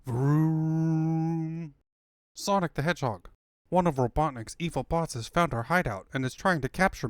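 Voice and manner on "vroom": held vroom effect; held spoken vroom sound. Acted. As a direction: voice the engine sound.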